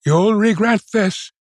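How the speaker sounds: high-pitched calling out, moderate volume; shouted threat. A narrator's reading. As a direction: call the threat back at them. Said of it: "he shouted".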